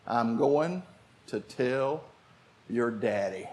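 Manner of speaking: slow, low and softening, with weighty pauses; tired mother. Acted. Weary mother warns her children she'll tell Daddy, slow and low. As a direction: say it as a worn-out mother's final threat. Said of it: "when she got tired".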